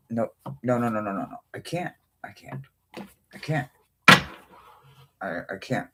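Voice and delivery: soft, breaking, almost voiceless "I can't"; whispered despair. Real, not acted. To her viewers over the ruined card, a faint despairing hush. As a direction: let it fade into a hopeless hush.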